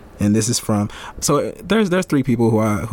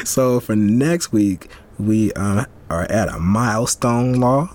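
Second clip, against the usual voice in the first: ordinary talk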